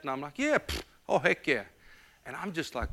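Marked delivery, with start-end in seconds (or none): 0.4-1.6 feigned confidence. Retold or imitated. Feigned ease when asked about money.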